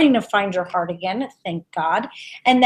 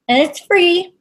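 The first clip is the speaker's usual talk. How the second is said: high, bright emphasis on free; excited; 7 semitones higher, 3 dB louder than usual. Real, not acted. Psychic hyping a free reading to viewers, excited.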